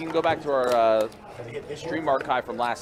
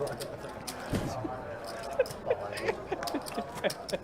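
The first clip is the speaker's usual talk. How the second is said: soft breathy laugh; 10 dB quieter than usual. Real, not acted. Laughing after French joke.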